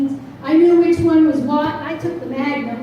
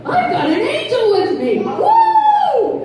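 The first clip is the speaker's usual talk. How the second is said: laughing, then a high whoop; thrilled she has an angel with her; laughing joy; 9 semitones higher, 3 dB louder, slower than usual. Retold or imitated. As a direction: celebrate with a laugh and a cry of woo. Joyful relief after danger.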